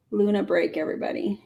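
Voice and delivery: usual speaking voice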